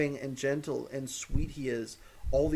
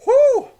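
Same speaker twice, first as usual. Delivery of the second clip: piercing whoo; 14 dB louder than usual. Real, not acted. Overwhelmed by the episode.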